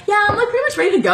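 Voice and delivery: high, fast, airy brush-off; breezy nonchalance. Retold or imitated. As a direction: toss it off quickly like it's no big deal. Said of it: "and she's like".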